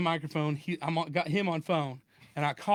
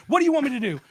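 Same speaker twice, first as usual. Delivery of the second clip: higher, louder fake-flustered plea; mock panic; 8 semitones higher, 7 dB louder, faster than usual. Victim pleading with the scammer in mock panic. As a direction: keep up the pretend panic. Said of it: "I'm kinda freaking out".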